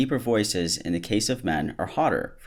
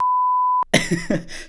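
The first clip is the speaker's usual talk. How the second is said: burst of laughter; 3 dB louder than usual. Real, not acted. Laughing mid-quote.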